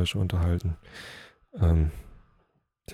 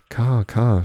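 higher call, only a hint of roaring; mild narrated cry; 4 semitones higher, 8 dB louder than usual. A narrator's reading. Elk crying out to the dog, understated roar. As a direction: cry out the name, held back. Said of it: "schrie Graufell, und es klang wie ein Brüllen".

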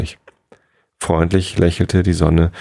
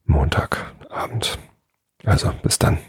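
whispered sign-off; 3 semitones lower, 4 dB quieter than usual. Real, not acted. Host ends the sleep podcast, whispering the day. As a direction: breathe the word out for sleepy listeners.

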